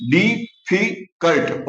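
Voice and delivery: डिफिकल्ट stretched slowly, syllables split; teacher slowing डिफिकल्ट into syllables. Shown as a demonstration. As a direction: slowly sound out डिफिकल्ट piece by piece. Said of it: "ये जो multi syllable है".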